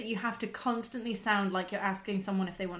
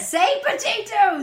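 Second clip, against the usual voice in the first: loud, high, drawn-out demand; goading shout; 9 semitones higher, 9 dB louder, much slower than usual. Pretend. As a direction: egg them on at full volume. Co-host goads the other.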